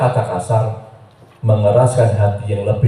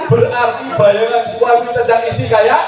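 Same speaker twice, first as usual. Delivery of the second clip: laughing disbelief; 12 semitones higher than usual. Real, not acted. Preacher laughing at a husband's nickname for his wife.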